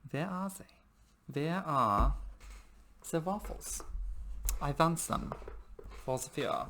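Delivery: put-on high, pleading German accent, a whispered bit in the middle; a hammed-up German accent, hunting for the waffles; mock German accent. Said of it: "that German accent".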